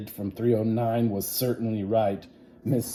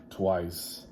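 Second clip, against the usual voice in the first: ordinary delivery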